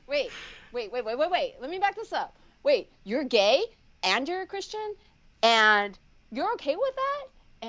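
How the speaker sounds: high, incredulous questions with a laugh; laughing disbelief. Retold or imitated. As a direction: stop her mid-sentence in disbelief.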